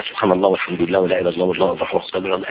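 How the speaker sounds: low, rapid run of mock dhikr; تمتمة تسبيح مقلّدة. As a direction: rattle off the praises fast and low, mimicking someone absorbed in prayer beads. Imitates judge busy with his beads. (تسبيح سريع بصوت منخفض، بتقليد ساخر)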